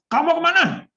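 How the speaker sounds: high, loud, scolding question; angry demo. Shown as a demonstration. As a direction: demand it angrily, as an acted example. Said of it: "Saya udah kayak orang marah belum?". (contoh marah, nada tinggi dan keras)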